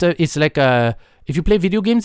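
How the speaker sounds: normal talking voice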